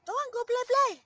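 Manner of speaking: high, coaxing push to go play. Real, not acted. Urging bird to bathe.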